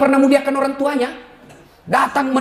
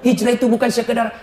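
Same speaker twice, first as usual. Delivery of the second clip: ordinary talk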